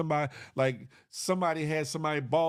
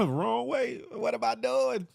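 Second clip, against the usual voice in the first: hoarse after choking; 7 semitones higher than usual. Real, not acted. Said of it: "choking on water".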